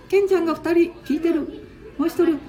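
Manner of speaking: put-on high, playful little-boy voice; squeaky grandkid voice; child voice. Voices Ken-chan again.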